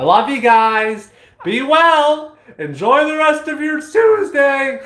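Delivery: high, loud, slow shouted farewell; beaming sign-off shout; excited goodbye. Real, not acted. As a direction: send them off with big cheer. Enthusiastic sign-off.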